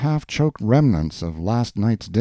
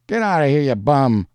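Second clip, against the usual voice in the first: raised, higher shout; 6 semitones higher, 5 dB louder than usual. A narrator's reading. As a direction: throw them out with a loud yell. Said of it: "a voice shouted".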